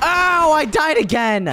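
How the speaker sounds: high, drawn-out cry of defeat; frustrated wail. Real, not acted. Dies again in game.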